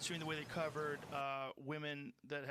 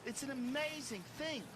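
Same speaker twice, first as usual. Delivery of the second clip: mock British pitchman, pitched higher; British salesman accent; 9 semitones higher than usual. Mocking late-night British salesman, British accent. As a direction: sell it like a British infomercial host in a hammed-up accent. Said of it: "They have a British accent".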